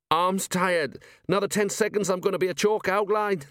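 high, strained plea; strained desperation. A narrator's reading. Dangling sergeant warns his helper he will fall, desperate. Dangling Colon pleads for help.

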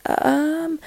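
high, soft, drawn-out um; retold stalling. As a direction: imitate being stuck, small and high. Stuck for an answer at work.

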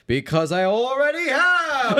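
laughing mock gloat; high slow gloating reveal. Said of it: "It's like".

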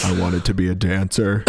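low, glum magician confession; uninterested David Blaine. Acted. David Blaine regretting being a magician, low and glum. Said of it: "he just seems so uninterested in everything".

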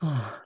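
low, breathy retold sigh; imitated massive sigh. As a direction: let out a big mock sigh. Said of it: "she just took a massive sigh".

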